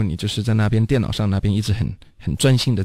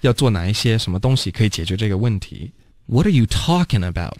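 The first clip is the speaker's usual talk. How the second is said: ordinary delivery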